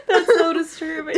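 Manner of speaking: laughing hard and high. Real, not acted. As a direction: crack up mid-sentence.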